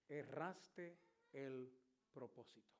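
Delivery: preacher repeating God's words to the congregation; hushed repeat. Retold or imitated. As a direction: savor each word, fading.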